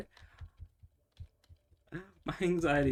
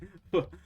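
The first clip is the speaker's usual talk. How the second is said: short laugh; 4 semitones higher, 6 dB louder than usual. Real, not acted. Laughs after cursing.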